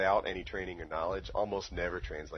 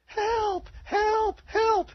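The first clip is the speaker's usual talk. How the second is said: very high, squealed cries for help; mock falsetto scream, each cry falling; mimicked screams for help; 5 dB louder, much slower than usual. Podcast guest imitating a prisoner's cries, mock screams for help. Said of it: "hears him screaming for help".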